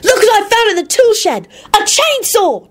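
put-on very high, gleeful child; happy holler. A narrator's reading. Boy showing off chainsaw at dinner, hollering happily. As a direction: holler out a thrilling find. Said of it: "Timothy hollered out happily".

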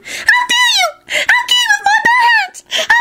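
extremely high-pitched squealing fury; tiny squeak. A narrator's reading. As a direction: squeal like a furious miniature man. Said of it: "squeaked in the highest-pitched voice".

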